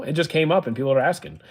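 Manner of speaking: usual speaking voice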